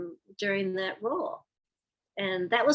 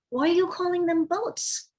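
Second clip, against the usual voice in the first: high-pitched incredulous question; retold bewildered challenge, voice lifted high; mock disbelief; puzzled; 10 semitones higher, 3 dB louder than usual.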